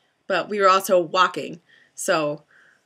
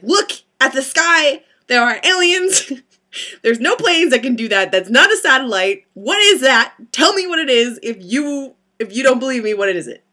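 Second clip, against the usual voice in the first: higher, louder insistence; desperate pleading; 5 semitones higher, 6 dB louder than usual. Retold or imitated. Daughter grabbing dad's face to make him look, pleading. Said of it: "grabbing his face and being like".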